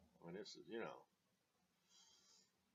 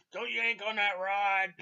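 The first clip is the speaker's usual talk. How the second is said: imitating an old captain yelling, much higher and louder; mock cussing captain; 11 semitones higher, 12 dB louder than usual. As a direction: bark it like a furious old sea captain. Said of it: "he was cussing at me".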